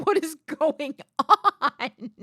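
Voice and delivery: slow, laughing disbelief. Real, not acted. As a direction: laugh through the question.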